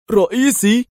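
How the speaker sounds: high, loud, stunned exclamation; thunderstruck. A narrator's reading. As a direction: cry out in disbelief. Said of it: "تراجع دار كالمصعوق هاتفا".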